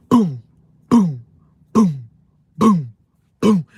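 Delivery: loud mimicked thuds; imitated knocking. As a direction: pound out each boom.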